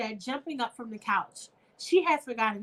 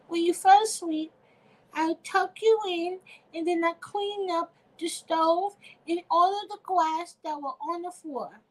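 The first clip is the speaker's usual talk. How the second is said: put-on high, earnest small child's voice; little kid voice; 8 semitones higher than usual. Little girl explains to her mother how she cleaned up, in a high kid voice. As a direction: speak like a proud five-year-old. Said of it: "that's my little kid voice".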